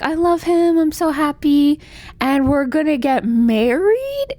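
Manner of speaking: high, gushing mock-happy voice; lovestruck daughter. Daughter tells mother about her fiancé, gushing and high. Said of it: "And her daughter's like".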